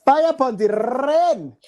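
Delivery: put-on high, loud Jamaican accent with rolled R; mock British-Jamaican accent. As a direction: belt out a hammed-up Jamaican accent. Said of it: "I gotta get British, British slash Jamaican here".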